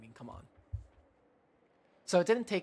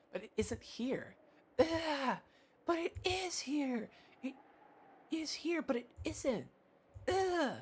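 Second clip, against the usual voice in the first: put-on very high, drawn-out little-girl whine; high tantrum voice with groaning ughs; whining child; 11 semitones higher, slower than usual. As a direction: plead like a stubborn kid who won't be told no. Child insists the rose is here.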